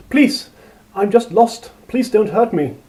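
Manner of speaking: high, loud desperate begging; frightened pleading. A narrator's reading. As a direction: beg for mercy, voice lifted in fear. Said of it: "Karen pleaded".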